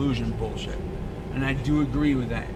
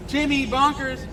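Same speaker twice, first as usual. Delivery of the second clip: high, louder call of the cat's name; 12 semitones higher, 3 dB louder than usual. Real, not acted. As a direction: call out to the lost cat. Calling lost cat by name.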